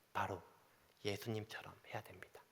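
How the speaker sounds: hushed, breathy whisper. Acted. Pastor urging congregation to follow Jesus, whispered. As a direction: drop to a whisper for the key point.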